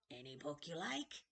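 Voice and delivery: a wheedling ancient keeper of books; creepy old librarian. Acted. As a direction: play a strange, eager old librarian. Librarian character voice.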